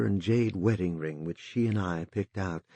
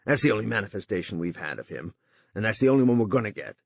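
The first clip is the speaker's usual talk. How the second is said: rising emotion, fast at the end; with undue emotion; 2 semitones higher, 4 dB louder than usual. A narrator's reading. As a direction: lose composure and rush the last words. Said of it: "with undue emotionality".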